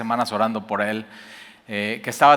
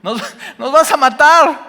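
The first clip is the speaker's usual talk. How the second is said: much higher, louder and slow; angry father shout; 13 semitones higher, 7 dB louder than usual. Retold or imitated. Father yelling at his teen driver, slow loud shout. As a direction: shout it down as the panicked dad. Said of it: "como papá vas a tener".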